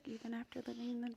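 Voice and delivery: reading in a hushed whisper; too soft, barely voiced. Real, not acted. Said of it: "add some volume".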